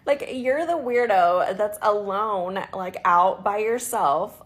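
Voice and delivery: loud, slow, indignant scolding; exasperated rant. Real, not acted. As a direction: hammer it home, fed up. Ranting about the rude man.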